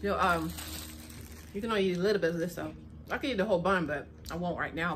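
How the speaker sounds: eating between words; chewing while talking. Real, not acted. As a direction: mouth partly full of bun. Seen 0: Holds bun, bites repeatedly, mouth working while talking throughout.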